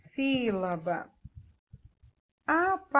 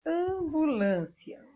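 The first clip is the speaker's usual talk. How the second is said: slowed down, syllable by syllable; 6 semitones higher, 4 dB quieter, slower than usual. Shown as a demonstration. Teacher showing pupils the syllables, slowly. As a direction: draw out each syllable of "Ambulância". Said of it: "Eu abro a boca quatro vezes".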